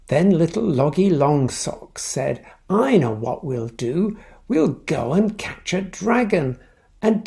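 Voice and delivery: lively storybook voice with character voices; reading to children. A narrator's reading. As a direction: read it like bedtime story time for kids. Reads picture book aloud.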